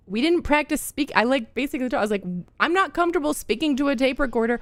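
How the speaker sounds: mock indignant student; retold protest to teacher. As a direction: argue your case to the teacher, stretched out.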